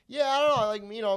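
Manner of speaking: high and loud nervous babble; awkward eager reply. Retold or imitated. Comic fumbling small talk with a lady, high loud nervous reply. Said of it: "I'm like".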